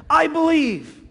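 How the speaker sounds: high, loud cry of faith; acted desperate declaration. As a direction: cry it out like a pleading father. Desperate father declares faith to Jesus.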